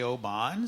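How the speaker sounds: Oban with stress thrown on a long "BAHN"; second syllable of Oban drawn out as "bahn"; mispronounced Oban. Shown as a demonstration. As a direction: say Oban as "oh-BAHN", lilting up at the end. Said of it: "but it's Oban".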